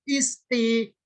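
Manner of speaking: "isti" lifted high, clear i at the start; Arabic vowel demo. Shown as a demonstration. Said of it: "alifnya ikut kasro".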